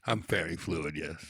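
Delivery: low, quiet fairy voice; deep, understated confirmation; low register. Pretend. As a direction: drop low and say it softly. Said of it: "your vocal register".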